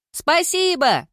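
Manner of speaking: loud, very high sing-out; shouted from afar. A narrator's reading. Girl answering from the stairs as she runs off, high shout. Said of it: "донеслось с лестницы".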